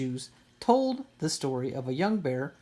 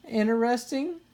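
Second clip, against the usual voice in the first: high puzzled question; 8 semitones higher than usual. A narrator's reading. Sister puzzled by Mama's word, rising question. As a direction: ask it, puzzled. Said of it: "in a puzzled voice".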